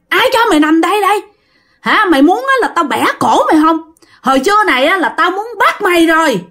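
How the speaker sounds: high, fast furious ghost threats; chửi giận dữ. Acted. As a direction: curse and threaten the old man to his face. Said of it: "Bắt đầu chửi nha". (hồn ma chửi, giọng cao và nhanh, giận dữ)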